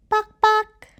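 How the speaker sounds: very high bawking hen; chicken bawk. Shown as a demonstration. Teacher bawking like a hen for kids. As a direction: squawk like a chicken.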